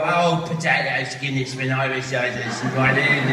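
slow, exaggerated Irish caricature; mock Irish accent. English people mocking an Irish immigrant, exaggerated Irish accent. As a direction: imitate someone mocking an Irish voice, slowly. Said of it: "people made fun of Irish voices".

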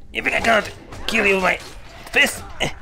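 usual speaking voice

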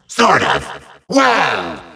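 ordinary talk, nothing marked

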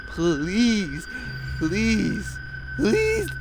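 high, strained pleading please; desperate begging. Real, not acted. Gamer begging the clock to reach six, desperate. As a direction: beg the game to let you live.

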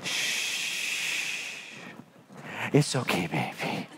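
hushed, whispered comfort; soothing mom. Retold or imitated. Mom hushes her crying son with a soft whisper. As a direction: soothe a hurt child in a hush. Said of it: "she goes".

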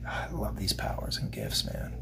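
awed whisper about gifts; whispered aside. Real, not acted. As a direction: drop to a whisper in awe. Hushed aside.

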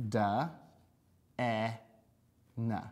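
segmenting each sound slowly. Shown as a demonstration. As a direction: sound out each letter separately. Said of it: "You write it first".

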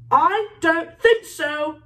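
slow, high roar, word by word. A narrator's reading. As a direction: roar it out slowly to stop someone. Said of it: "roared Moody".

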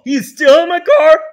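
loud, high, outraged; angry shout. Acted. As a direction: yell it in shock. Dad shouting.

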